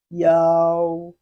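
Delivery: loud, drawn-out yao for students to copy; Chinese yao. Shown as a demonstration. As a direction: model yao clearly. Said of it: "observe where we mark our tones".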